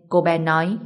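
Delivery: ordinary delivery, nothing marked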